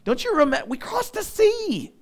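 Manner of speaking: an exasperated leader pleading with a stubborn crowd; Moses, fed up, voice climbing high on the sea; frustrated Moses. Retold or imitated. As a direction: pitch jumps way up in exasperation. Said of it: "Moses even gets frustrated with them".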